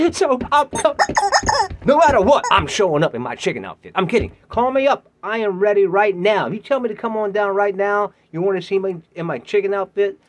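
high, loud eager talk; eager pitch. Pretend. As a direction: sell yourself with energy. Excited pitch to producers.

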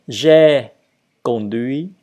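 deliberate, emphatic conjugation drill; repeating for learners. Shown as a demonstration. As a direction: draw each word out for learners to copy. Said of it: "Et donc, je répète".

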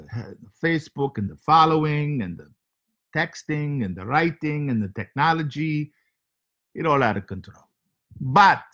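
comic foreign host with broken English; heavy comic accent, broken grammar. Acted. Comic foreign character Sergey hosting show.

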